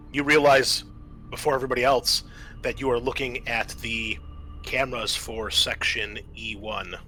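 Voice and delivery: usual speaking voice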